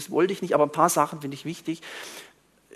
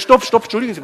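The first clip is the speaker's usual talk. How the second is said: loud, high acted call to halt; urgent interruption; 6 semitones higher, 10 dB louder, faster than usual. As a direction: cut in to stop someone. Acting out himself interrupting a man.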